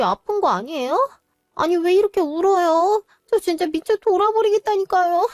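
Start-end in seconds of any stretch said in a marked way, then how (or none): 1.6-5.3 higher and louder, at the end of her rope; a frazzled, desperate plea; exasperated complaint. Acted. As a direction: wail it out, fed up. Exhausted mother complaining of crying.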